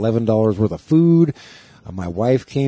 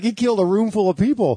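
mock caller; 9 semitones higher than usual. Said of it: "He's like".

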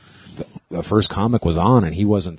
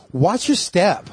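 sassy drag queen; 7 semitones higher, slower than usual. Retold or imitated. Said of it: "He's all".

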